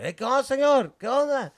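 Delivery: high, loud sing-out hello; friendly homie's greeting. Retold or imitated. Homie greeting his friend's dad at the car window, high friendly holler. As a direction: hail someone's dad across the way, loud and high.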